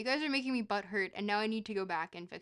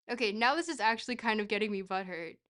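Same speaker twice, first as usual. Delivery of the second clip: normal talking voice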